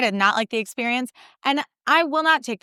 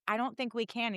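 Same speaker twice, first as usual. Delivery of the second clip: much softer, unsure mumble; hesitant; 3 semitones higher, 12 dB quieter than usual. Retold or imitated. Speaker timidly telling dad they can't wait inside, much softer. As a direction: barely object.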